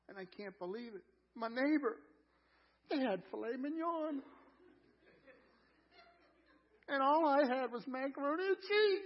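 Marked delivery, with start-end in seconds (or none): 1.3-9.0 higher, rising mock outrage; hammed-up jealous whine; mock envious complaint. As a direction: play up the envy, voice climbing high on the last words. Mock complaint envying neighbor's dinner.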